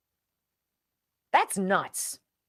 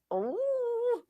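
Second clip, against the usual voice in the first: delighted squeal; 4 dB quieter than usual. Real, not acted. Dreaming of Oprah show.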